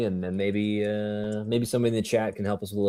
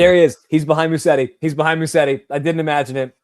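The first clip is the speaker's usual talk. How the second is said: high, louder burst of excitement; excited spotting; 7 semitones higher, 6 dB louder than usual. Real, not acted. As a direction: blurt out the thrilled discovery. Suddenly spots the man he sought.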